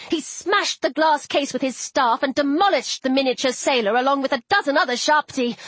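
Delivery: plain reading voice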